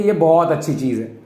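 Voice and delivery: ordinary talk, nothing marked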